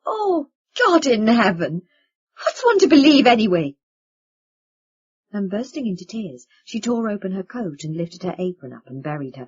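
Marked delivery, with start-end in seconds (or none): 0.0-3.8 anguished cry. Acted. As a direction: cry out near tears. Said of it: "Hannah suddenly crying".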